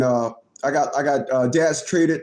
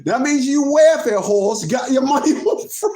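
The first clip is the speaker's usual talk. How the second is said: laughing; 5 semitones higher, 4 dB louder than usual. Real, not acted. Repeats joke while cracking up.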